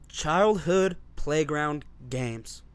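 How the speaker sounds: very slow topic announcement. Acted.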